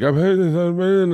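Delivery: high-pitched mock crying voice; imitated sobbing. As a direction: mimic someone sobbing through their words. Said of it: "she's sobbing, crying uncontrollably".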